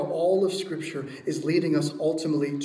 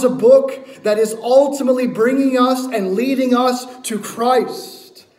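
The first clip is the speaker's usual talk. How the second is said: raised, loud and insistent exhortation; full-force preaching; 6 semitones higher, 7 dB louder than usual. Real, not acted. Preacher urges listeners to read Scripture this way, full-force preaching. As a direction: press it on the congregation, drawing out the final words.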